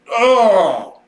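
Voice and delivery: normal talking voice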